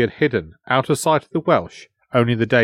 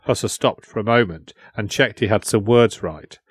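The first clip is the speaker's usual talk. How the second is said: ordinary delivery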